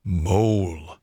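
a quiet, dry "Mole"; the Badger calling him to attention. Badger addressing Mole, dry and quiet. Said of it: "in his dry, quiet way".

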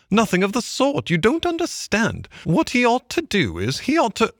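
high-pitched, insistent Mole; shouted objection. A narrator's reading. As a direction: argue back in a high little voice. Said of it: "shouted the mole".

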